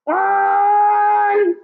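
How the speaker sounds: high loud puppet shout; the puppet yells instead of whispering. Pretend. Puppet Sammy shouts the number at class. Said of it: "that was not whispering".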